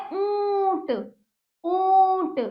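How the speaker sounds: teacher sustaining the nasal vowel in ऊंट; nasalised vowel demo. Shown as a demonstration. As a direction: stretch the ऊं of ऊंट through nose and throat. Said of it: "देखिए गले से भी आपका".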